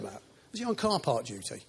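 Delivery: ordinary delivery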